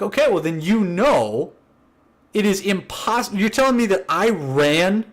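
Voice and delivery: acted-out disbelief, rising as it goes; incredulous protest. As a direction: argue how absurd the accusation is, climbing in pitch. Acted argument, protesting he couldn't have.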